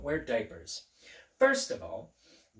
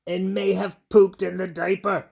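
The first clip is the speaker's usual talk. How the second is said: louder and higher, building excitement; excited reading; 5 semitones higher, 9 dB louder than usual. Acted. Librarian reads to children, growing excited and loud. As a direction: ramp up over the screaming baby.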